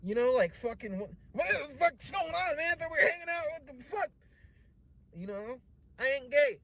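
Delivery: high, loud frantic objection; panicked protest. Retold or imitated. Comic protesting to the host on the couch, high panicked yelling. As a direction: yell it out in a high-pitched panic.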